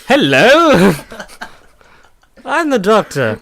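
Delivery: loud, high mock Doctor hello; loud Doctor greeting. Podcaster mimicking a clip, loud and high. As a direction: belt it out like a hammy Doctor. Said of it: "where he just goes".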